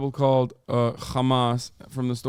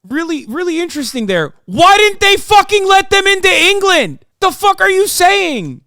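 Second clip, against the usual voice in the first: very high, loud angry yelling; furious rant; 11 semitones higher, 8 dB louder than usual. Real, not acted. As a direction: shout in outraged disbelief. Furious outburst at guest.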